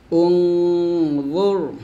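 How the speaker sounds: normal talking voice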